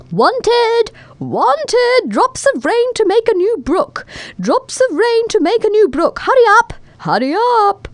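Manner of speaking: high, loud calling from afar. A narrator's reading. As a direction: shout out high like a town crier wanting help. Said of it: "heard a voice calling".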